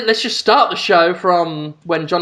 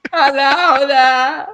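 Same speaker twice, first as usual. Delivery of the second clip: high, drawn-out hammed-up Jersey Shore voice; slow cartoonish Jersey Shore hello; Jersey Shore impression; 5 semitones higher, much slower than usual. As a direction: stretch out a silly, high-pitched greeting. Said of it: "Do you like my authentic voice?".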